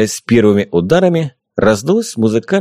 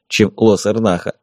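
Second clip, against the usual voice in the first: plain reading voice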